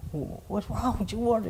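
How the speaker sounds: high, soft, startled voice; retelling brother's alarm. Retold or imitated. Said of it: "and he was like".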